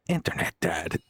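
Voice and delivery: low, gruff Snake impression; a rough try at Snake's growl. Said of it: "My snake impression's normally a bit better".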